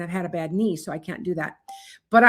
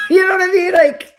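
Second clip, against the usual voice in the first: laughing; 11 semitones higher, 10 dB louder than usual. Real, not acted. Laughing at her own joke.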